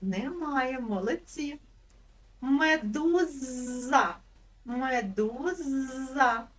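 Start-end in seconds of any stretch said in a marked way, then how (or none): 2.4-6.5 Медуза slow, with з held long; stretched z. Shown as a demonstration. Teacher stretching Медуза to show children its з. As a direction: lean on the з in Медуза, drawn out slowly. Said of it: "Чуєте звук з?".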